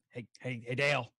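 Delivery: very low, soft lurking voice; creepy low voice. Acted. Player haunting Dale from behind, low and creepy. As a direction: creep up on him, low and quiet.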